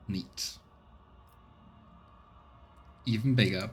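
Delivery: ordinary delivery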